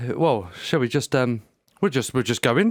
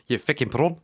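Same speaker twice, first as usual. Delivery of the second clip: put-on light South African accent; joking South African voice. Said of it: "South African accent".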